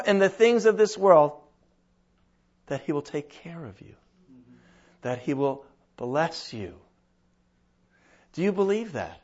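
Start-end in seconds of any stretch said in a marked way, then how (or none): 2.7-6.8 hushed reassurance. Real, not acted. Preacher softens to assure.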